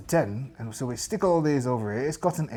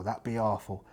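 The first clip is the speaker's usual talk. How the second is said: normal talking voice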